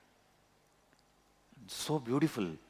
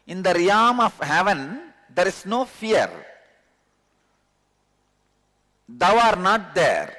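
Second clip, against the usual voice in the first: loud, raised scripture reading; solemn recitation; 5 semitones higher, 5 dB louder than usual. Acted. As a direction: proclaim the verse solemnly. Reads translation with force.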